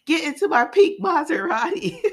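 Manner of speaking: high and loud, hammed-up hero line; hype hero line. Retelling Darius's rescue to a friend, hammed-up high hero shout.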